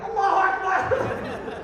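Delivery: very high, drawn-out mock cheer; high-pitched, stretched-out chant, laughing; imitated crowd cry. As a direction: mimic an ecstatic crowd crying out slowly.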